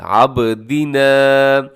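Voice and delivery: आबदीना with a bounce on the ब, slow; आबदीना with qalqala. Shown as a demonstration. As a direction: let the ब in आबदीना echo. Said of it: "आपने इसको हिलाना है".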